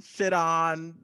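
normal talking voice

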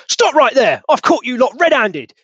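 high, loud alarmed cry; startled shout. Acted. Witness shouting at the group. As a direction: yell to catch them red-handed. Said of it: "he goes".

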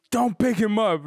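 loud, high mock yell; drunken outburst imitation. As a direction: blurt it like a drunk suddenly waking. Voices drunk partner's sudden outburst.

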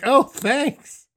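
high, loud, exaggerated thanks; mock-offended thanks. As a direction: squeal a hurt, sarcastic thank-you. Mock thanks to mom's brush-off.

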